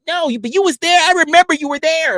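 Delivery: very high, loud protesting imitation; insistent mock accuser. Accuser insisting streamer was there, high mock protest.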